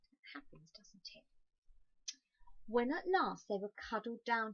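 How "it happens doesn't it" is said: soft unvoiced confiding whisper; whispered aside. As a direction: let them in on a secret. Confiding aside to listening children.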